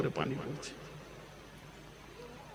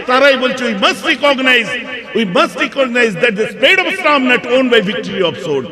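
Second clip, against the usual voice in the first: high, loud oratory; ringing, declamatory sermon delivery; full-force preaching; 13 semitones higher, 11 dB louder than usual. Acted. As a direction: proclaim it to a big crowd.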